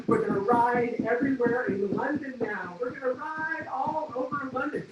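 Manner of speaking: giddy plan; excited. Real, not acted. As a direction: gush about riding all over London. Thrilled about biking London.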